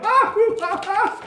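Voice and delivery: high, loud whooping laugh; hammed-up villain laughter; dragon king's cackle. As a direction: hoot and cackle like a mad king. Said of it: "and then he's like".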